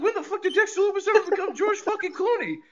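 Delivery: high-pitched laughing disbelief; incredulous. Real, not acted. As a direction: squeak it out in comic outrage. Mock disbelief at commentator's praise.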